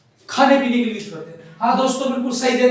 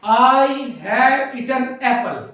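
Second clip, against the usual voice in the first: very slow, each word drawn out; slow smooth reading; much slower than usual. Shown as a demonstration. Teacher modelling the sentence slowly for students. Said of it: "सिर्फ सीधा सीधा smoothly पढ़ा जाता".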